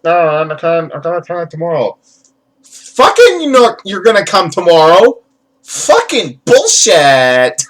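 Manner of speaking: put-on high-pitched Valley Girl tantrum; Valley Girl wrestler rant. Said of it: "with that Valley Girl voice".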